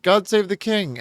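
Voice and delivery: high-pitched mock exclamation; mock rallying cry. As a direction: exclaim it as a mock-patriotic slogan. Mock patriotic cry in a retelling.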